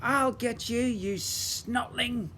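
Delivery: high, dragged-out snarl; old goblin threat. Acted.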